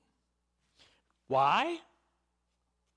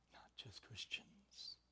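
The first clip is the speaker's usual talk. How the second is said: slow, breathy whisper to the congregation; a hushed, slow secret; 6 semitones lower, 15 dB quieter, slower than usual. Real, not acted. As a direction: lean in and whisper it. Whispered emphasis in sermon.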